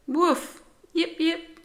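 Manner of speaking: high happy woof and yips; dog greetings. A narrator's reading. The dogs greet Rosie at the park, happy barks. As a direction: bark a happy hello.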